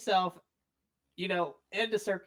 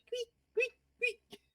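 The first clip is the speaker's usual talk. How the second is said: much higher squeak noises; squeaky bounce sound. Acted. GM making the Squeak Squad's footsteps, much higher squeaks. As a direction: squeak like a bouncing toy ball.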